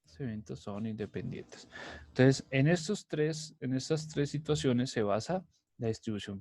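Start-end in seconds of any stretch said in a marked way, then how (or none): 0.0-1.7 softer murmured "Los eventos son independientes", said to himself; teacher muttering the rule as he writes it down; quiet repeat. Shown as a demonstration. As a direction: repeat "Los eventos son independientes" under his breath while writing it. Repeats rule softly, likely writing.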